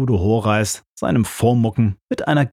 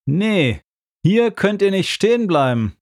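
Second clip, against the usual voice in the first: raised, high call from behind; 9 semitones higher than usual. Retold or imitated. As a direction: call out to strangers blocking the spot. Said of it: "rief eine Stimme hinter uns".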